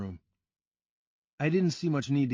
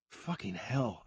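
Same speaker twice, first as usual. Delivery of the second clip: muttered; 6 dB quieter, slower than usual. A narrator's reading. Narrator after being punched, muttering softer. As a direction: curse under the breath. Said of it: "I muttered".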